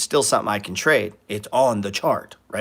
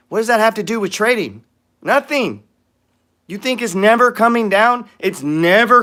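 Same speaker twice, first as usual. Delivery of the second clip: high, scoffing disbelief; mocking disbelief at a silly excuse; incredulous; 8 semitones higher, 4 dB louder than usual. Real, not acted.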